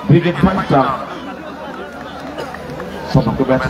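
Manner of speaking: usual speaking voice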